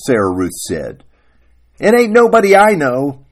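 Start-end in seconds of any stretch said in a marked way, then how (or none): 1.8-3.2 loud, high denial; forceful acted retort; dismissive wife. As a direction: reject it in a raised, quarrelling voice.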